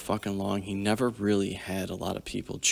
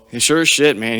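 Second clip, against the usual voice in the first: normal talking voice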